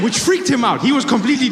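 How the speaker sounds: normal talking voice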